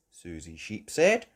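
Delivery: plain reading voice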